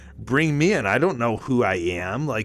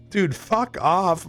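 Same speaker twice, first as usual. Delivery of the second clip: drawn-out disbelief; 7 semitones higher, much slower than usual. Retold or imitated.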